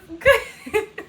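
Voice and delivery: high, bright laugh at herself; high rueful laugh. Real, not acted. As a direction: laugh off the misery. Laughing at own misery.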